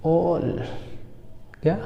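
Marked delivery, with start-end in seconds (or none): 0.1-0.6 ऑल as a drawn-out "awwl"; teacher's stretched ऑल; long o vowel. Shown as a demonstration. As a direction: hold the rounded vowel in ऑल. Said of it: "क्या पढ़ोगे?".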